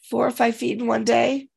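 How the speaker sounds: ordinary delivery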